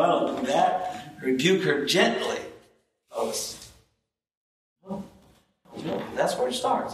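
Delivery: ordinary talk, nothing marked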